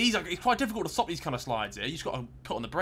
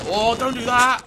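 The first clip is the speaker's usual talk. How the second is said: alarmed cry; 7 semitones higher, 9 dB louder, slower than usual. Real, not acted. Watching a motorbike near miss.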